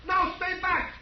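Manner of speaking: high, tense warning to stay back; scared fugitive warning the approaching marshal; frightened warning. Acted. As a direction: hold him off in panic. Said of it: "That's a frightened man".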